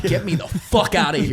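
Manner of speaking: exasperated plea. Retold or imitated. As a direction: beg to be rescued.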